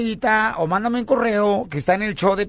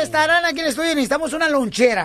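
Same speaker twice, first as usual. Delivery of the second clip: excited radio announcer; 8 semitones higher than usual. Acted. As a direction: sell it like a radio promo.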